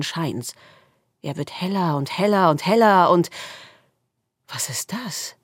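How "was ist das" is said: breathless whisper; awed whisper. A narrator's reading. Squirrel sees the firelight and whispers in awe. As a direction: whisper in wonder.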